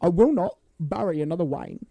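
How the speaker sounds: hammed-up British butler impression; solemn mock British refusal; Michael Caine. Co-host returning to the butler bit one last time, hammed-up impression. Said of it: "Michael Caine's a fun one to do".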